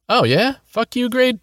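high, whiny mocking kid voice; whiny copycat voice. A narrator's reading. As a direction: mimic him in a high whine. Opposite copies, mocking.

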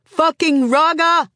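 loud, high, drawn-out curse; angry shout. A narrator's reading. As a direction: hurl the insult slowly over the crowd. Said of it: "Someone else shouts her down".

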